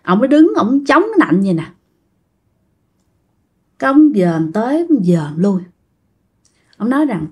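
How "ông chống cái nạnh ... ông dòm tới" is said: ordinary delivery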